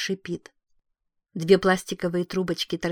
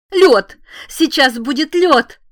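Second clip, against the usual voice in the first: loud, high, slowed shout; sharp panicked shout; 8 semitones higher, 11 dB louder, slower than usual. Acted. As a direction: shout it out, desperate. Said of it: "и резко добавляет". (резкий отчаянный крик)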